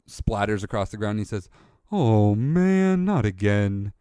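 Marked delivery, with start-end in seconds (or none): 1.9-3.9 fed-up toy hippo voice; dismayed hippo shopkeeper. Acted. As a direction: sigh it out like a shopkeeper who dropped the eggs again.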